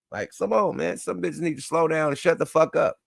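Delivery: usual speaking voice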